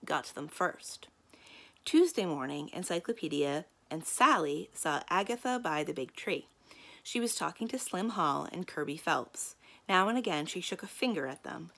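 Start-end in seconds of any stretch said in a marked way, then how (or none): none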